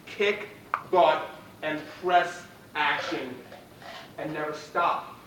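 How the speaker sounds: loud, fired-up pep talk; rally the team, full voice; rallying shout. Real, not acted. Rallying call, team answers.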